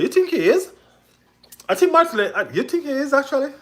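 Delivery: you can't believe what chat just said; incredulous. Real, not acted. Disbelief at chat's claim.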